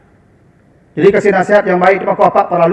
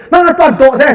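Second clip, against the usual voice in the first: raised, louder imitation of an indignant wife; wife's inner grumble; 8 semitones higher, 5 dB louder than usual. Preacher voices a scolding wife's inner thought, mock indignant grumble. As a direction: act out her silent demand in a high, loud voice. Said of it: "hati kecil ibu tuh".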